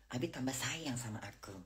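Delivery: soft, whispered delight; hushed and low, doting; lovestruck whisper. Real, not acted. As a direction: whisper it like a sweet secret. Musing to herself about husband's love.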